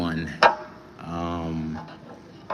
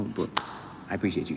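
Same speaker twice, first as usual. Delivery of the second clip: usual speaking voice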